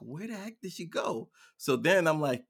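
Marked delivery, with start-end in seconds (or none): 0.0-1.3 retold bewilderment. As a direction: replay growing bafflement at a vanished child. Still looking, retold.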